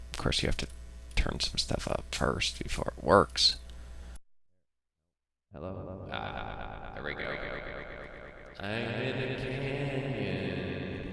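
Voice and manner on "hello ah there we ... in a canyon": canyon echo. Shown as a demonstration. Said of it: "Canyon Delay".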